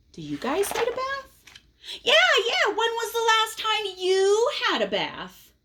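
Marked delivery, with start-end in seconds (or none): 2.0-5.5 high, squeaky cartoon pigeon. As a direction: play the pigeon, bright and pushy. Pigeon voiced, reading aloud.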